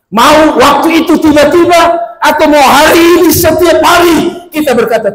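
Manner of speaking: high, loud preacher's shout; preaching at full force. Acted. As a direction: preach it out to the congregation. Preacher shouting his appeal.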